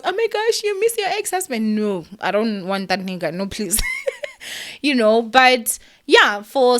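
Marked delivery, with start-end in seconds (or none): 0.0-1.8 high, sing-song mockery of imagined viewers; mocking quote. Teasing viewers who think she misses her ex, high mock voice. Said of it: "I'm not crying because". 3.8-4.8 high, breathy burst of laughter; real laugh. Real, not acted. Laughing off the idea she wants her ex back.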